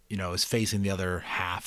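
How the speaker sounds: plain reading voice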